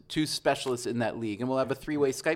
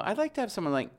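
ordinary delivery

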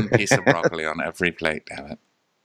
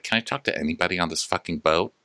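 ordinary delivery

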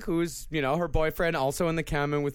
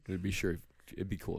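quiet mock; 9 semitones lower, 10 dB quieter than usual. Mocking the lyric quietly.

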